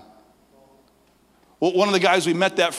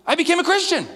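much higher, louder proud declaration; joyful announcement; 5 dB louder than usual. Retold or imitated. Ren telling his friends his news, high and joyful. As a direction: announce it beaming.

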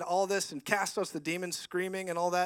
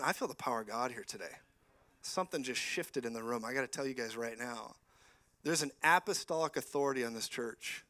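softer, lower intimate prophecy; prophetic declaration; 4 semitones lower, 4 dB quieter than usual. Acted. Preacher prophesying over Ben and Kara, hushed and intent. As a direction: speak a word over the pastors gently.

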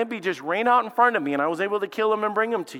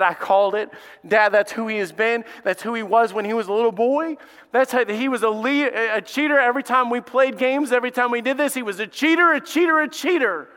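higher, louder, building outrage; bitter accusation; 7 semitones higher, 4 dB louder than usual. Acted. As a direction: spit out cheater again and again. Esau accusing his brother.

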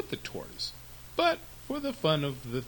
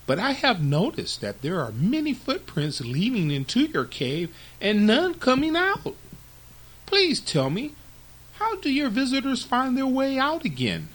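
higher, louder fox voice; wary, polite fox; 11 semitones higher, 6 dB louder than usual. Acted. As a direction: question the lion in a raised, high-pitched voice. The wary fox questions the lion.

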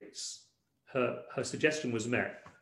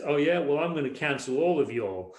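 put-on Texas accent; 2 semitones higher, 4 dB louder than usual. Jesus cuts in on his squabbling followers, Texas accent. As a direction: do a jokey, loud Texas accent. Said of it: "doing it in a Texas accent".

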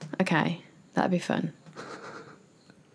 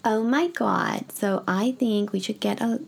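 put-on slow, higher-pitched California girl; California girl accent; 4 semitones higher than usual. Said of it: "I can do it usually".

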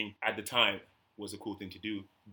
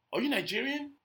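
much higher, eager question; mock the diner's curious voice; imitating the customer; 14 semitones higher than usual. A waiter imitating a customer's eager question, pitched much higher. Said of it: "and he goes".

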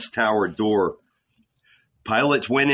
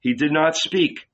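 plain reading voice

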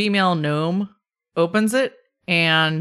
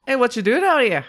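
lightly acted Boston accent, pitched up; attempted Boston accent; 5 semitones higher than usual. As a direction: greet strangers in a rough Boston accent. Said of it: "do slow down in a Boston accent".

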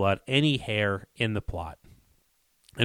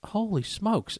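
astonished; 10 semitones higher, 4 dB quieter, slower than usual. Retold or imitated. Reacting to the discovery.

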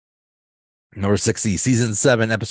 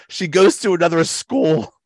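high, loud defensive reply; Kevin voice; 9 semitones higher, 6 dB louder than usual. Retold or imitated. Kevin answers Angela, high and defensive. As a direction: insist it proudly. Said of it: "And Kevin says".

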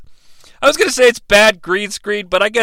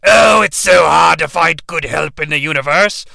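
Zed voice; 4 semitones lower than usual. Acted. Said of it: "Zed's like".

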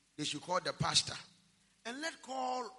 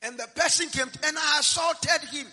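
loud punchline; 5 semitones higher, 8 dB louder than usual. Real, not acted.

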